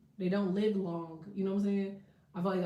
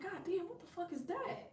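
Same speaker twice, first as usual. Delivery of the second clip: startled alarm; 10 semitones higher, 8 dB quieter than usual. Retold or imitated. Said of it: "I'm like".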